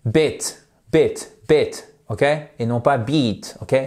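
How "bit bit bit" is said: Bit repeated with a short i; short i drill. Shown as a demonstration. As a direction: model the drill slowly. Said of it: "Allez-y".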